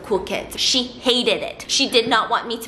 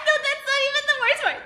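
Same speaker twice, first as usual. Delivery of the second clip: ordinary delivery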